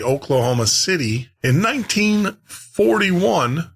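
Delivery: announcer flourish. Acted. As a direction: roll out the title like an old radio host. Introduces the episode with flourish.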